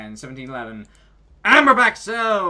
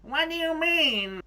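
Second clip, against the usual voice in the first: high squeaky voice; 13 semitones higher, slower than usual. Acted. Squeaky character line.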